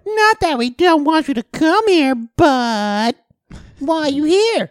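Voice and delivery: high, loud prissy voice; mock the snooty bank clerk; imitating teller. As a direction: teller asking why he's there, in a high fake-polite voice. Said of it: "one of the other ones was like".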